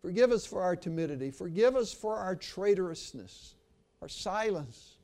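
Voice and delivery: earnest pastoral prayer with swells on key pleas. Acted. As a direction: lead the church in prayer. Preacher leads closing prayer.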